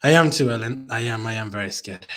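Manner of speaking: normal talking voice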